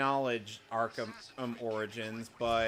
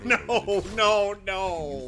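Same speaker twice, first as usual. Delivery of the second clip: high, loud string of refusals; loud, pitched-up protest; 8 semitones higher, 8 dB louder, much slower than usual. Real, not acted. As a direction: cry out to veto the idea. Refusing a suggested voice.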